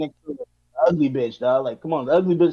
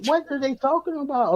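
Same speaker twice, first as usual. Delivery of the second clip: incredulous question; 10 semitones higher than usual. Retold or imitated. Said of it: "I'm like".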